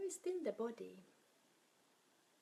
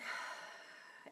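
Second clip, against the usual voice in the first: long audible breath out; demonstrated exhale sigh. Shown as a demonstration. Teacher shows listeners how to exhale and relax shoulders, long sigh. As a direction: sigh the air out as a model to follow.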